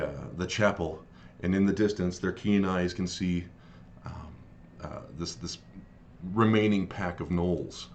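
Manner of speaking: ordinary talk, nothing marked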